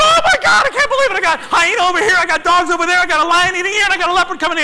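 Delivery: high, loud, overwhelmed rant; hammed-up ecstatic yelling; frantic disbelief. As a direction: pile up the list in giddy amazement. Acts out his disbelief.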